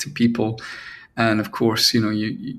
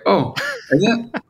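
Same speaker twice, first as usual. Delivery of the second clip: high, fake-innocent surprise; mock surprise; 11 semitones higher than usual. Songwriter feigning surprise at the manager's praise, coyly high. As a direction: play it coy, pitch way up. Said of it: "you're like".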